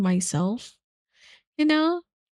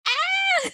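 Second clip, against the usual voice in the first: high squealing shriek with a laugh; high excited laughing squeal; fangirl squeal. Retold or imitated. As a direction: let out a giddy laughing scream. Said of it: "then I'm like".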